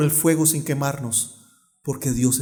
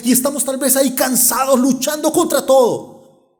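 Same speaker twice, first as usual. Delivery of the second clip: building from even to high, insistent preaching; fervent preaching; 5 semitones higher, 3 dB louder than usual. Real, not acted. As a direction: pile up each struggle, rising in intensity. (predicación insistente que va subiendo)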